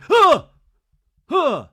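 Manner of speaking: sharp loud startled gasps. Retold or imitated. Craig gasps when Shep grabs his shoulders, loud startled gasps. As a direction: gasp loudly as if grabbed from behind. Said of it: "and Craig's like".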